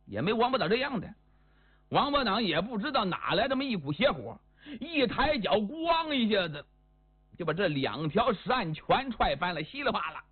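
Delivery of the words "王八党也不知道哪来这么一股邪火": plain reading voice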